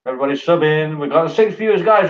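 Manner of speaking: ordinary talk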